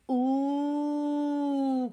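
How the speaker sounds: high drawn-out ooh; delighted ooh. A narrator's reading. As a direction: marvel at it together. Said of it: "cried the animals all together".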